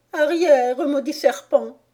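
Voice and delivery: high, loud warning cry; squawked threat; angry pigeon. A narrator's reading. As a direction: drive the intruder away, loudly. Pigeon drives Alice away.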